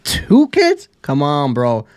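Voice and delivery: can't believe what you're hearing; disbelief. Real, not acted. Repeats incredulous question.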